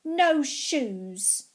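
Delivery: put-on loud defiant child's yell; shouting. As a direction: bark it out, refusing the shoes.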